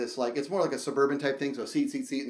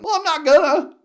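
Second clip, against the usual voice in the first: loud, higher, cocky refusal; defiant; 13 semitones higher, 12 dB louder than usual. Real, not acted. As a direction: toss it off defiantly. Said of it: "So I should probably slow down".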